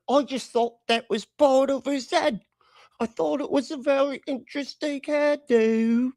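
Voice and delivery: high, silly mocking voice; mock clueless hairdo voice; mock dim voice. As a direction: squeak it out like a clueless fool.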